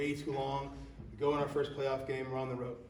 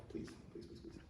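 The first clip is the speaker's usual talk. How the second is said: soft whispered please, please; whispered pleading; 15 dB quieter than usual. Retold or imitated. Coach begging quietly from the sidelines, whispered. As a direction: beg under the breath. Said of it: "I go".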